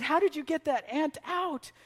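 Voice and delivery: put-on a kid asking with wonder; hint of a curious boy. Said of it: "and I said".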